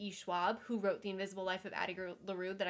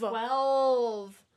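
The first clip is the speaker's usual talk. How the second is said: excited; 11 dB louder than usual. Real, not acted. Echoing the countdown happily.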